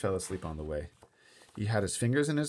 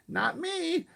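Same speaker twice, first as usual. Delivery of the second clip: put-on toddler denial. Fudge denies again.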